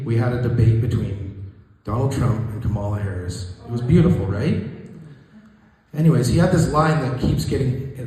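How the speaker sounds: ordinary delivery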